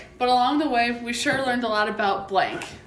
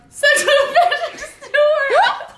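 laughing; 14 semitones higher, 4 dB louder, slower than usual. Acted. Trailer line breaks into laughter.

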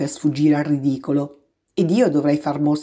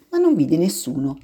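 ordinary delivery

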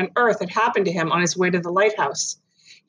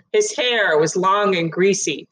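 plain reading voice

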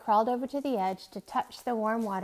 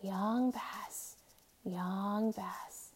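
hushed, tender calling of his name; low and soft; 6 dB quieter, much slower than usual. A narrator's reading. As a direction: soothe a frightened boy softly. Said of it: "low and soft".

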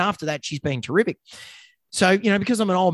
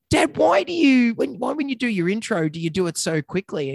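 put-on child voice; 6 semitones higher than usual. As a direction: pipe up like a curious kid. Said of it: "and the kid said".

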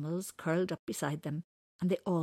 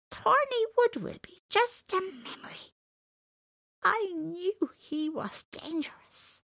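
villain voice; 9 semitones higher, 6 dB louder than usual. Retold or imitated.

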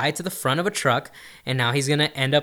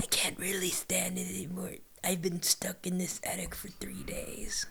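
breathy whisper; 5 semitones higher, 14 dB quieter than usual. Retold or imitated. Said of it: "toy voice in attic".

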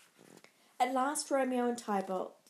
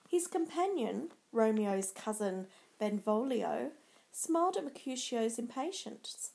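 ordinary delivery, nothing marked

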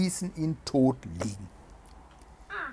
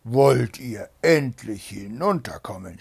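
loud, impatient Bluebeard yell; shouting; 9 dB louder than usual. A narrator's reading. Bluebeard yells for his wife, impatient shouting. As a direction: demand it loudly, patience gone. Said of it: "schrie Blaubart".

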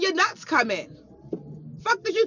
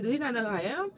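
ordinary talk

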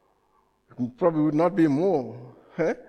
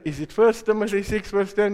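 ordinary talk

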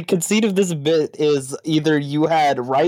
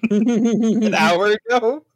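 slow, high, laughing "an hour ago?"; laughing disbelief; 8 semitones higher, much slower than usual. Real, not acted. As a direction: stretch it out in amused disbelief. Incredulous at rice timing.

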